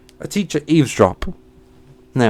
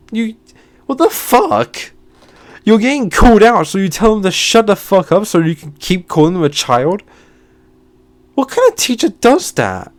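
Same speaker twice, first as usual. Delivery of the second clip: outraged rant; 6 semitones higher than usual. Real, not acted. Ranting about the teacher who silenced students, outraged. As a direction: tear into the teacher.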